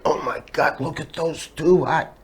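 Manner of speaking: hushed, slowed mock ogling; drooling admiration. Two bartenders gawking at hired dancers, mock drooling. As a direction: imitate bartenders quietly lusting over dancers. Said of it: "just drooling".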